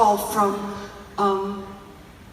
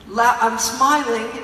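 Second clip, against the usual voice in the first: ordinary talk, nothing marked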